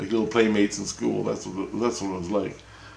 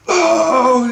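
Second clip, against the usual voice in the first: alarmed cry; 10 dB louder than usual. Retold or imitated. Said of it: "He goes".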